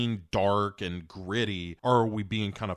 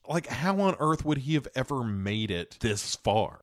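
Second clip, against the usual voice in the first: ordinary talk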